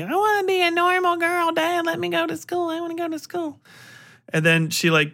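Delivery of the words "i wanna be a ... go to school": put-on high, pleading little-girl voice; girl's pleading voice. Girl begs her dad to let her attend school, high and pleading. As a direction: beg like a girl who wants school. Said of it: "she, like, wants to be".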